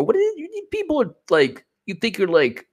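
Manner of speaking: high mocking voice of an employee. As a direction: jeer in a high put-on voice. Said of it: "Employee four".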